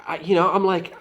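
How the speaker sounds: ordinary delivery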